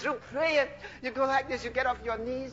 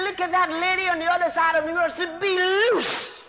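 high, insistent preacher's cry; bold declaration; 6 semitones higher, 4 dB louder than usual. Acted. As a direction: declare release with authority.

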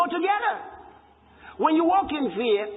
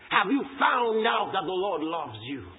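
ordinary talk

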